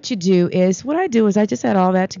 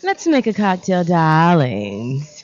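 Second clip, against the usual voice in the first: sassy diva greeting the fans; campy drag queen. Pretend. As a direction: vamp it up, darling. Cocktail show host greets "darlings.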